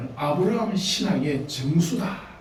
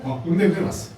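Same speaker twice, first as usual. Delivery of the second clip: usual speaking voice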